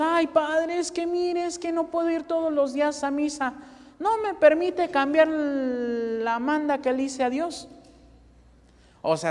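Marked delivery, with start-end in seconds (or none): 0.0-7.7 whiny excuse. Retold or imitated. Preacher imitating a parishioner making excuses to the priest, high and whiny. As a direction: whine your excuse to the priest. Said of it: "Y luego vamos".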